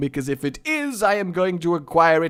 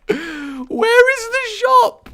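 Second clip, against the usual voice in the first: very high, slow whine; squeaky despair; 6 dB louder, much slower than usual. Real, not acted. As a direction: stretch it into a squeak. Exasperated search, squeaky.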